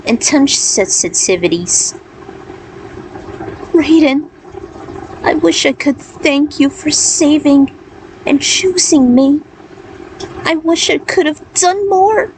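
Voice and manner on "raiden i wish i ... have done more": Aiko voiced higher, close to tears; tearful young woman. Aiko's tearful thanks to Raiden.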